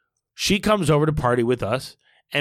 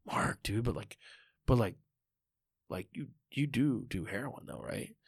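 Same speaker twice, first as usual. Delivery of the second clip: hushed aside; 3 semitones lower, 10 dB quieter than usual. Retold or imitated. Asking Lauren about heroin quietly.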